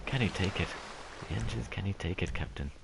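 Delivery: put-on soft, hushed Scottish engineer accent; quiet attempt at a Scottish ship's engineer; Scotty. Asked to do the Star Trek engineer, soft Scottish accent. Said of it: "Try and do Scotty from, from Star Trek".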